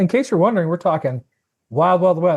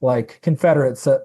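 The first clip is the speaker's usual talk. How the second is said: usual speaking voice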